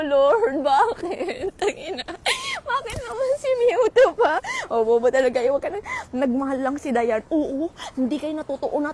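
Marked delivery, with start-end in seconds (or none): 0.0-4.4 higher, laughing mock lament; hammed-up laughing wail; mock wail to Lord. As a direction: wail to heaven in jest. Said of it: "Sabi ko".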